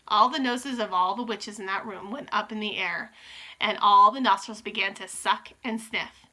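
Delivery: ordinary delivery, nothing marked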